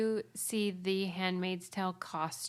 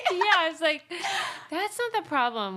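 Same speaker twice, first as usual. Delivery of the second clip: laughing; 8 semitones higher, 4 dB louder than usual. Real, not acted. Laughing at costume.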